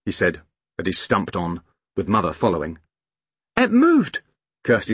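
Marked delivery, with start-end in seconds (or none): 3.6-4.2 put-on high, amazed little girl; startled excitement. A narrator's reading. Girl seeing the strange thing move, startled. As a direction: blurt it out high, in surprise.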